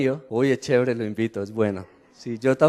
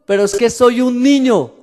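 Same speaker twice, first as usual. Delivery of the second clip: put-on higher, louder pleading young prophet; pleading Jeremiah; 6 semitones higher, 8 dB louder, slower than usual. Jeremiah protesting to God, loud high plea. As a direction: plead like a scared youth before God. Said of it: "Cuando Jeremías le dice".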